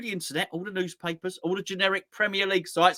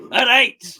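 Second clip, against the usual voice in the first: sudden loud, high shout; comic burst of authority; mock manager shout; 7 semitones higher, 9 dB louder than usual. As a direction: bellow it like a fed-up gaffer. Pretend manager ends the dressing-down.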